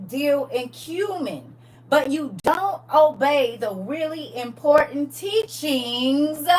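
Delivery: slow, loud preacher's reading; scripture read at full force; preaching scripture. Acted. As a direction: lean into each word like a pulpit reading. Reads scripture aloud with force.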